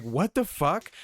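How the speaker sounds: high incredulous what the fuck; retold disbelief at the disclaimer. Reacting to disclaimer.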